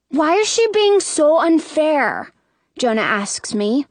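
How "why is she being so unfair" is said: put-on high, drawn-out kid's grievance; complaining little boy.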